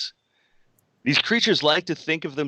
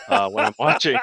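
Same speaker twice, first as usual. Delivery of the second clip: laughing; 10 semitones higher, 6 dB louder than usual. Real, not acted. Laughing admission.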